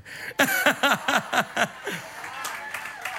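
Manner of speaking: unvoiced laugh; airy chuckle; breathy laugh. Real, not acted. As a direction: laugh silently at the punchline. Laughing at greeter punchline.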